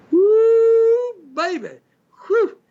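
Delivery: high, loud woo; excited whoop. Real, not acted. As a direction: whoop like he's impressed. Excited about sexy ladies' show.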